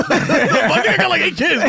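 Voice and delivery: mock frazzled dad. Mock excuse, high and loud.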